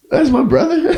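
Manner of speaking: high, loud surprised squeal. Retold or imitated. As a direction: squeal it in disbelief. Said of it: "I was like".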